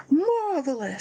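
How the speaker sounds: high, drawn-out catchphrase; exaggerated slow "marvelous" impression; Lee. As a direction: stretch it out high, with a falling finish. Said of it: "now it's Marvelous".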